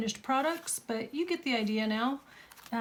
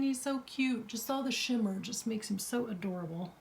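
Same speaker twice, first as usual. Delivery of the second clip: ordinary talk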